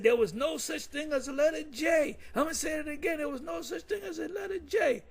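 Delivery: high shouted imitation of the bishop, in a voice unlike his own; imitating Bishop Nate. Quoting Nate's Saturday night teaching to the viewers, a high shouted impression. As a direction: belt it out high like the preacher he is quoting. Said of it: "every once in a while he'll say".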